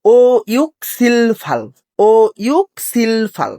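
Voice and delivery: slow, deliberate ও ইউক সিল ফাল; slow teacher's recitation of the numbers. Shown as a demonstration. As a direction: spell out each number for learners. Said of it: "তাহলে আমরা কি পড়বো?".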